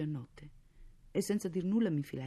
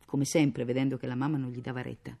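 usual speaking voice

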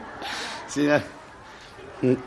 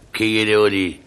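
put-on Roman accent; 7 semitones lower than usual. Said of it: "il mio è un romano così milanesizzato".

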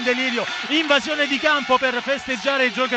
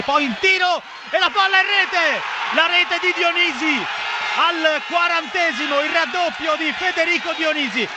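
excited goal call; 5 semitones higher than usual. Real, not acted. Goal call in radio commentary.

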